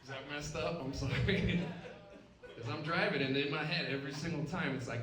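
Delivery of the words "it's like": usual speaking voice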